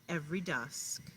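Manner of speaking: ordinary talk, nothing marked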